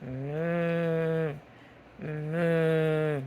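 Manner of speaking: low, drawn-out moo; cow moo. Shown as a demonstration. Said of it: "What does a cow say".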